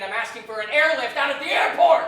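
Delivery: yelling into the phone, laughing; angry shout. Acted. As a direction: demand an airlift at full volume. Furious demand on phone.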